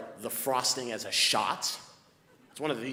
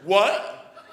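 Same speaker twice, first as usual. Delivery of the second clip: mock baffled what; 7 semitones higher, 13 dB louder than usual. Clerk's loud puzzled reply.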